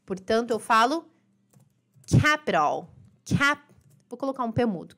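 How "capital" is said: "capital" said "capiral", t tapped like an r; American flap T. Shown as a demonstration. Teacher models the American "capital" for students, flapped t. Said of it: "o som do T vira R".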